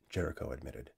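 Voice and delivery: plain reading voice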